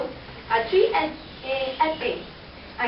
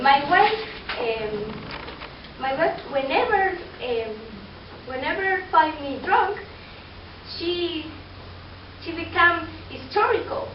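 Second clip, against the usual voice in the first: ordinary talk, nothing marked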